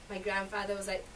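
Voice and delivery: ordinary delivery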